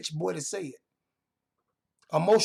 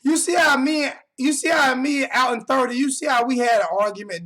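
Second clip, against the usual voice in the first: raised voice; 5 semitones higher, 6 dB louder than usual. Real, not acted. Heated point to livestream viewers.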